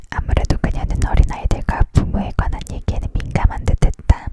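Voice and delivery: plain reading voice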